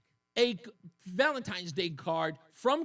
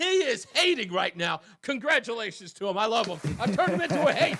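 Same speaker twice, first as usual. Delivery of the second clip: teasing; 4 semitones higher, 8 dB louder than usual. Real, not acted. Teasing co-host on air.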